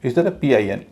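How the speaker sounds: normal talking voice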